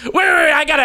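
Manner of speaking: usual speaking voice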